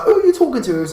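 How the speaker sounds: indignant woman. Retold or imitated. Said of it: "And she was like".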